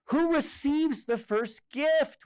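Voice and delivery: put-on eager children. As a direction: ask it breathless like eager kids. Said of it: "we all asked".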